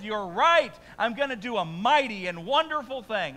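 God answering the prophet, loud and sure; God's answer. Acted. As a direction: speak as God, declaring. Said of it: "And so God says".

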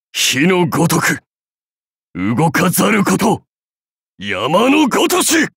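creed building into a cry; rising incantation. Acted. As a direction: rise steadily in pitch to a forceful finish. (唱えながら次第に高く力強く)

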